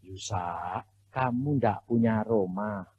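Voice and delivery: low, soft, slow and calm God voice. Retold or imitated. Preacher voicing God telling him he has no house, low and slow. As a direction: speak down gently as the Lord. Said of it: "Tuhan bilang gini".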